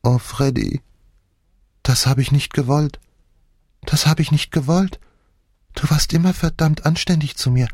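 put-on high, pleading childlike voice; a grown man suddenly sounding like a little boy; like a child. A narrator's reading. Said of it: "er klang wie ein Kind".

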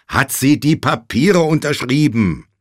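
loud, high, emphatic repeat; impatient insistence. A narrator's reading. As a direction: drum the question into a groggy listener. Josh repeats question, impatient.

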